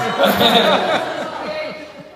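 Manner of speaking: high bursting laugh; loud laugh. Real, not acted. Embarrassed about his Portuguese.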